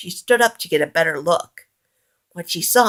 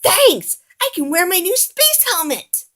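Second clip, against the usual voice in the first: excited Gonzo; 12 semitones higher than usual. A narrator's reading. Gonzo thrilled.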